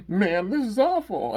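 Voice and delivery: high, slow, hammed-up moan; mock despair. Dan sitting there after Drago vanished, high mock-despairing moan. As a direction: wail it in exaggerated dismay.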